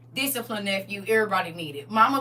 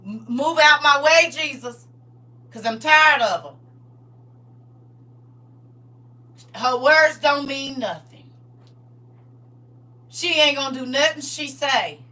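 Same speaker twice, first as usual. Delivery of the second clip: angry God; 3 semitones higher, 4 dB louder than usual. Acted. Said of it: "talking about".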